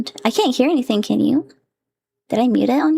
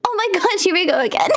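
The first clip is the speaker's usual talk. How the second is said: normal talking voice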